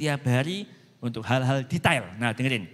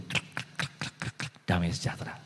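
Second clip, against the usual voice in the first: breathy krek krek like a heart being fixed; whispered creaking sound; 9 semitones lower, 9 dB quieter than usual. Shown as a demonstration. Preacher mimicking God mending the heart, whispered creaks. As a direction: hiss out the creaks softly. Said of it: "hati ini kayak diberesin Tuhan".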